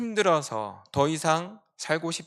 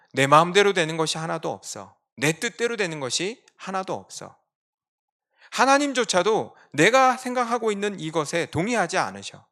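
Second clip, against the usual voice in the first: loud, aggrieved lament, pitch climbing; bitter complaint; 4 semitones higher, 6 dB louder than usual. Retold or imitated. Preacher speaks as Jonah, bitter loud lament. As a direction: Jonah railing that nothing goes his way.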